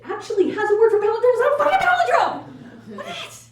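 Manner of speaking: high, loud indignant yell; outraged shout. Pretend. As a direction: explode in mock outrage.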